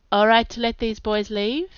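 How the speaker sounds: ordinary delivery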